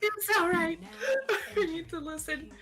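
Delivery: squeaky laugh through the words; barely getting it out between laughs. Real, not acted. Laughing fit after teasing.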